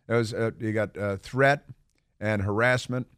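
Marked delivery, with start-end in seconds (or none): none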